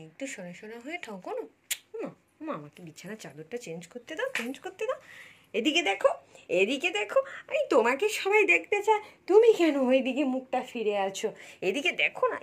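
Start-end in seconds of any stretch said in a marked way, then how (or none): 5.5-12.4 loud coaxing. Real, not acted. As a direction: get her to turn, loud. Mother calling baby to look. (বাচ্চাকে জোরে ডাকা)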